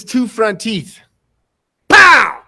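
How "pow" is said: loud, high burst; sudden storytelling sound effect; explosive impact word. Retold or imitated. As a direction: punch it out like a comic-book hit. Voicing the stick's impact.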